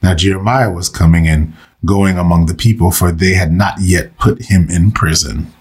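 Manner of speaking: ordinary talk, nothing marked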